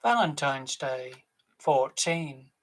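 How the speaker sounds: loud, drawn-out bingo calls; bingo caller. Acted. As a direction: call the numbers across the hall. Bingo caller calling numbers.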